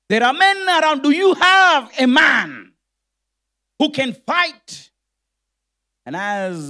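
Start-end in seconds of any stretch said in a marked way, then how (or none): 0.1-4.9 full-force pulpit roar, slowing at the end; preacher's challenge. Acted. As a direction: thunder the question at the congregation. Preacher roars a challenge at church.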